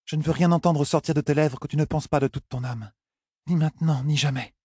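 high-pitched, solemn heroine; high, heartfelt young woman's voice; earnest young woman. Acted. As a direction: insist on sincerity in a high feminine voice. Heroine demands only sincere words.

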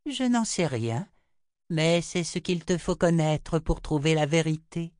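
high-pitched, composed seer voice; Chota, unmoved. A narrator's reading. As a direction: answer high and evenly, giving nothing away.

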